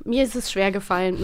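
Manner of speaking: ordinary talk, nothing marked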